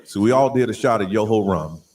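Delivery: very low electronic bass narration; deep bass voice changer. Pretend. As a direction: tell the tale through a deep voice changer. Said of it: "a little more deep bass".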